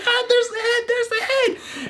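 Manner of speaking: high, laughing squeal of excitement; 兴奋地尖声笑喊; excited. Retold or imitated. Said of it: "that's where I got excited".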